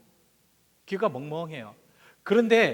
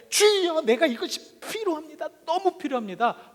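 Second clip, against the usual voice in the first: high opening cry to the Lord, then easing; 7 semitones higher than usual. Acted. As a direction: act out an urgent prayer of need. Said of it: "하고 부르짖으라는". (주님께 부르짖는 흉내)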